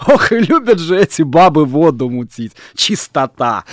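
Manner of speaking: loud, high jeer after a laugh; mock her as you laugh; laughing scorn. A narrator's reading. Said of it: "Николай засмеялся".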